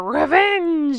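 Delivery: drawn-out, mock-dramatic "revenge"; running-gag revenge. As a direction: stretch the word out theatrically. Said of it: "I gotta say it like that".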